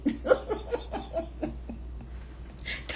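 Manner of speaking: airy high-pitched laugh bursting out; high breathy laugh. Real, not acted. Laughing at the story, high airy laugh. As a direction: laugh it out, almost silent and high.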